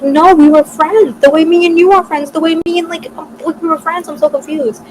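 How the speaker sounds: high, strained upset voice; upset confusion. Retold or imitated. Crying to her friend about the bet, high and upset.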